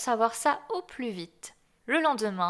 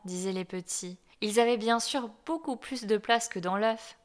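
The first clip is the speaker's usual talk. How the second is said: plain reading voice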